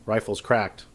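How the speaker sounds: ordinary delivery, nothing marked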